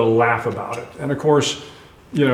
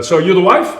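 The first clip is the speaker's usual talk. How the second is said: Australian doctor; 6 semitones higher, 6 dB louder than usual. Retold or imitated. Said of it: "this kind of ruddy-faced Australian fella".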